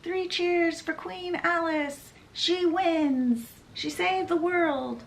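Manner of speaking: high, bright cheer of a crowd. A narrator's reading.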